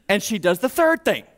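ordinary talk

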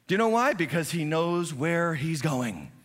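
high, loud, driving sermon voice; preaching at full force. Acted. Pastor urging the congregation to give, preaching at full force. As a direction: preach it hard to the whole room.

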